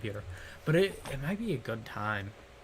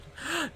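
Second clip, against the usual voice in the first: mock gasp. Shocked gasp at watermark.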